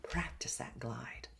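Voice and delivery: quiet whispered instruction. Shown as a demonstration. Coach whispers practice advice to the class. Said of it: "lesson instruction whispered".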